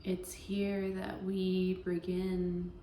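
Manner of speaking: slow, even meditation voice with long pauses; guided meditation. Acted. Yoga teacher easing listeners into Yoga Nidra, guided meditation voice. Leads a guided relaxation practice.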